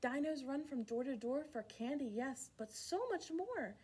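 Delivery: ordinary delivery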